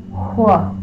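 "Wh." blown out as a W with a strong rush of air; airy wh sound. Shown as a demonstration. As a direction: push lots of air through the "Wh.". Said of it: "it makes the W make lots of air".

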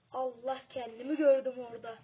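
loud startled exclaim. Real, not acted. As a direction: blurt it out in shock. Startled by seeing himself. (şaşkınlıkla yüksek sesle)

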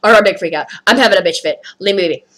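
normal talking voice

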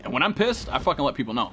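normal talking voice

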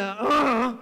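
loud, drawn-out mock groan; imitated groan. Preacher re-enacting his fall in the dark, groaning. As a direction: groan like hitting the floor. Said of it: "I'm doing the".

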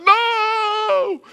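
high, hammed-up wail; sobbing little girl. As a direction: cry it out like a distraught child. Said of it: "just sobbing all over again".

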